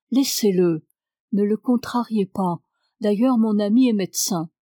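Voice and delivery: ordinary delivery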